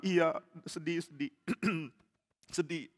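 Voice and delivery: soft mock sniffling; pretend sadness. Follower agreeing he is sad, softly faked weeping. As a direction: trail into small, put-on sniffles. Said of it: "kita pura-pura mau nangis".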